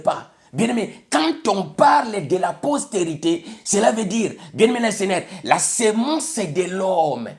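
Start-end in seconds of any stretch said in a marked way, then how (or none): none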